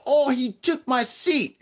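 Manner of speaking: high, drawn-out mock yell in a put-on accent; caricatured foreign woman's shrill complaint; imitated accented yell. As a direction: imitate a tourist yelling, pitched high and slow. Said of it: "she, she yelled".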